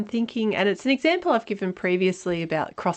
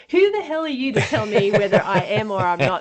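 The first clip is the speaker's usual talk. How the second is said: indignant retort; 3 semitones higher, 5 dB louder than usual. Retold or imitated. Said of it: "I'm thinking".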